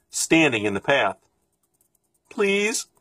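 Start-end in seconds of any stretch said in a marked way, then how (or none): 2.3-2.9 put-on high, pleading child's voice; small hungry boy. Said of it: "said the boy".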